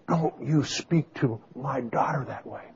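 quiet, restrained scolding of an offended mother-in-law; retold maternal anger. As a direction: imitate a mother's hushed warning. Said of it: "her mother got mad".

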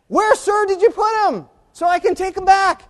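high, loud wailing demand; distraught plea. Acted. Mary to the supposed gardener, distraught shouted plea. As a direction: cry out desperately at full pitch.

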